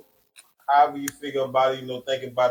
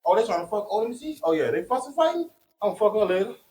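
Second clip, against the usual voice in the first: high, sly voiced bystander; mocking bystander voice; 6 semitones higher than usual. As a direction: play the sleazy onlooker. Mimics opportunist bystanders.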